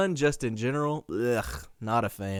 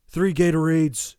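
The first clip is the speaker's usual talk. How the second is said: loud, higher call; mock demanding holler; demanding dad; 6 semitones higher, 8 dB louder than usual. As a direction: call out an urgent order as dad.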